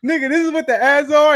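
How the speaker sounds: high, loud excited exclaim; retold amazement. Telling friend his reaction to the ad, high excited exclaim. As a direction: marvel at it out loud. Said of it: "I'm like".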